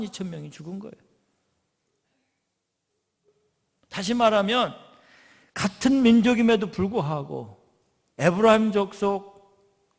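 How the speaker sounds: loud, driving sermon delivery; forceful preaching. Acted. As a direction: preach it out to the congregation. Preaching returns to full force. (힘 있게 외치는 설교)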